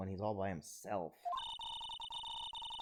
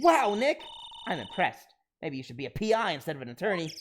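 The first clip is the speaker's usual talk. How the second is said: high, loud wow easing into praise; bright-pitched burst of admiration; impressed sidekick; 6 semitones higher, 7 dB louder than usual. Acted. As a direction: play an eager sidekick, amazed at their friend.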